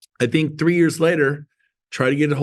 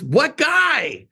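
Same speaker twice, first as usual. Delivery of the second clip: high mock holler, falling at the end; calling back; 11 semitones higher than usual. Coworker answers the receptionist off the phone, mock hollering back. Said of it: "She muffles the phone, but I could still hear".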